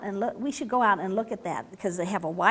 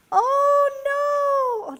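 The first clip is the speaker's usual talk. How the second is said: high, louder panicked cry; alarmed cry; 6 dB louder than usual. A narrator's reading. Frogs falling from the sky, crying out high and loud.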